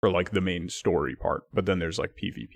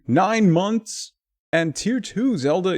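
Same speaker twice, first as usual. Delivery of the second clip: excited hype; 10 semitones higher, 5 dB louder, slower than usual. Real, not acted. Streamer hyping a resub.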